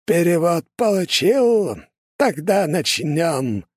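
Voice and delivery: put-on high, slow, creepy old sorcerer; eerie voice. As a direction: drag each word out like a ghoulish old man. Sinister old curse-maker voiced.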